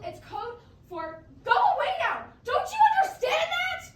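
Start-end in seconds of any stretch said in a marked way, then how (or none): 1.4-3.9 high loud frantic outburst; hysterical. Real, not acted. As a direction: lose it, shrill and loud. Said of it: "You're making me hysterical".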